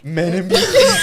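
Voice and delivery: high, loud laughing disbelief; amused disbelief at a friend's odd phrase; incredulous echo. Real, not acted. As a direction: repeat it back, laughing in disbelief.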